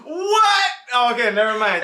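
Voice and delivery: laughing surprise. Real, not acted.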